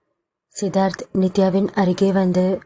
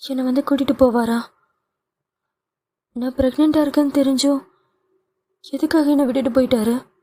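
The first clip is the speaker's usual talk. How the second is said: put-on higher, mildly tearful young woman; pleading daughter; 4 semitones higher, faster than usual. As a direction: plead with mother, a touch of tears. Daughter crying, anxious.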